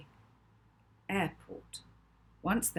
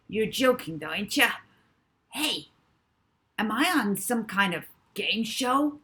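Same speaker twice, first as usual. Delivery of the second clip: disbelieving porter; 5 semitones higher, 6 dB louder than usual. Acted.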